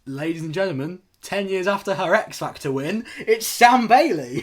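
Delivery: rising high, loud announcer build-up. Retold or imitated. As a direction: introduce the star like a host. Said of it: "Charlene goes".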